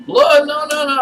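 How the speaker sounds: high, loud, alarmed "no, no, no"; startled denial. Acted. Chuck, caught out by the guest, denies it in a high, alarmed voice. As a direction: jump in fast to deny it. Host caught near blood pile.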